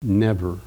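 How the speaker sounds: Never said low and falling, alone; stressed never. Shown as a demonstration. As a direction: drop low and land Never as one firm word. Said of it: "the emphasis on that word never".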